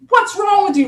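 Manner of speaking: high, sharp scolding question; retold coach's scolding; coach getting after me. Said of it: "so he was getting after me".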